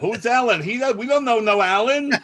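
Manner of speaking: high, loud mock bafflement; hammed-up who-is-this outcry; indignant yelling. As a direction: holler it like an outraged old guest. Echoing the indignant guests.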